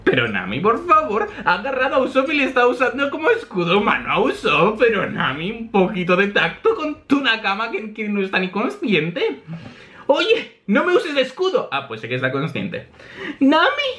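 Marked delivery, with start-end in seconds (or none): 0.6-9.4 high-pitched incredulous scolding; mock outrage. Real, not acted. 10.1-11.7 high-pitched indignant protest; acted affronted character; Usopp. Usopp objects to being a shield. 13.4-13.9 very high-pitched cry; calling out. Acted. Character calls out to Nami.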